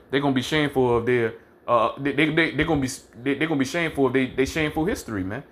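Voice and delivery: normal talking voice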